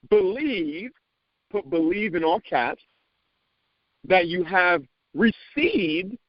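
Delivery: slow, high, solemn scripture reading; preacher reading Mark eleven to the room, slow and solemn. Shown as a demonstration. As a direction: read the verse slowly and reverently, ending in a breath. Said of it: "I'm gonna say that again".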